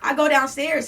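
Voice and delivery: loud, high, hurried retelling; worked-up rush. Retold or imitated. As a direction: get loud as if running out the door. Rushing out, worked up.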